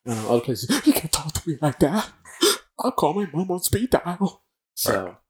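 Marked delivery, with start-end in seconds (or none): 0.8-4.4 high, slowed mocking whine of a coddled rookie; whiny recruit. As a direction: sneer at the kid who threatens to call mom. Mocking a soft new recruit.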